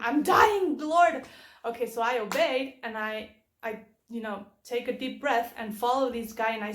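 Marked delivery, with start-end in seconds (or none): 0.0-1.2 higher and louder, frightened plea; panicked cry. Retold or imitated. Driver crying out to God in panic. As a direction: cry out that you're dying. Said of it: "And I was like".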